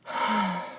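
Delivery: long exhaled sigh; regretful sigh. Acted. Friend realising the hurt caused, regretful sigh. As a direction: let the breath go before apologising. Sighs before apologising.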